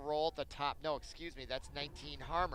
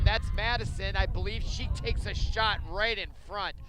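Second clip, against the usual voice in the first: louder, higher fast-break call; excited play-by-play; 3 semitones higher, 5 dB louder than usual. Acted. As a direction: build up the rush like a live commentator.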